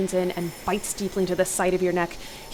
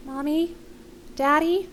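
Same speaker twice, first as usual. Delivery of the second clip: put-on high, questioning little-boy voice; scared child; 9 semitones higher, slower than usual. A narrator's reading. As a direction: call out like a frightened young son. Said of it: "and he sounds scared".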